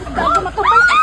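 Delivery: high angry yell; shouting. Acted. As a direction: yell it out in shock. Shout after crash.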